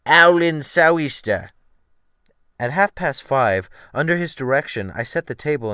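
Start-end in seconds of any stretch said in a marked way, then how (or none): 0.0-1.5 loud, slow Cockney sailor's phrase; rough Cockney shout; Cockney sailor accent. A narrator's reading. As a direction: bellow it in the cook's Cockney. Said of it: "what Mister McGridge called".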